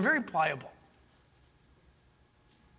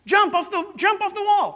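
put-on high, loud child's call; an eager kid calling; little kid voice; 11 semitones higher, 6 dB louder than usual. Child urging a grown-up to jump, high and loud.

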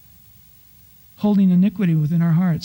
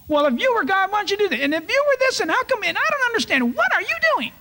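much higher, whining, nagging questions at God; mock-whine a petty complaint to God; whiny complaining; faster than usual. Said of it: "they begin to argue and complain".

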